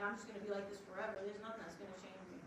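hopeless inner voice, soft and quick; resigned. Retold or imitated. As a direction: give up as the words trail off. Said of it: "I'm like".

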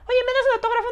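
very high, fast fan voice; excited fan. Retold or imitated. A fan asking him for a photo, high eager voice.

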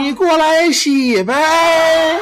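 high, slow, syrupy coaxing; flirty coaxing wife. Retold or imitated. Wife luring her husband into the bath, high and slow. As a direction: draw every word out to seduce. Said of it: "我这骨头都酥了".

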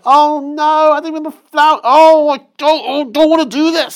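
very high, loud whine; whining boy. Acted. Five-year-old whining to his mother, very high and loud. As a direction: whine like a kid who won't do chores. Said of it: "And it's like".

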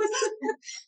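high, loud real laugh; burst of laughter. Real, not acted. Laughing while retelling son's words.

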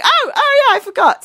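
high, loud realization; sudden remembering. Retold or imitated. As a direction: imitate a scatty hostess remembering. Retold hostess suddenly remembers.

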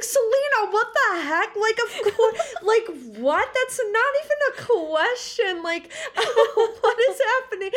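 high, loud, laughing disbelief; overjoyed. Retold or imitated. Answering her friend's offer, laughing and overjoyed. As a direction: gush in happy disbelief.

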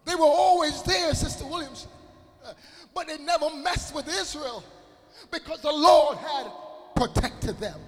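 full-force preaching. Acted. Preacher shouts to the congregation that the Lord protected them. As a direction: build to a roar on the Lord.

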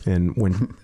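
normal talking voice

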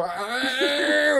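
imitated half-crying wail, pitched high; long drawn-out "aah", imitating a tearful cry; mock wailing scream. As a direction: wail it high without real volume. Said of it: "huilend te schreeuwen".

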